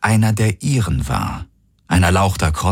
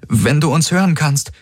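higher, uncertain address; unsure; 9 semitones higher than usual. A narrator's reading. Kirai addressing the ship in Krondari, unsure. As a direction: call it out tentatively, pitched up. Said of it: "sagte sie unsicher auf Krondari".

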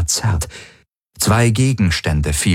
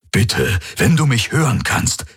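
urgent plea, pitch jumping up mid-phrase; pleading; 6 semitones higher than usual. A narrator's reading. Hawks begs Garlin to hear him, pleading. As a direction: beg him to hear you. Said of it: "flehte Hawks".

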